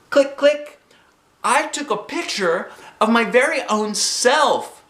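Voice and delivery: high, loud, playful clicks; excited narration. A narrator's reading. Junie B takes her own picture, high and playful. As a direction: snap the clicks like a toy camera.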